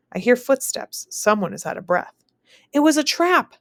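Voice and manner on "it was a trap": high, loud alarmed cry. Acted. Breathless messenger warning the soldiers, alarmed cry. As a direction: cry out the bad news. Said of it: "the man cries".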